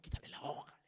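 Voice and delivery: whispered aside to a companion; urgent whisper. Retold or imitated. Telling a companion to take the sheet away, urgent whisper. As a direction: whisper it as a furtive order. Secretly urging companion to stop reader.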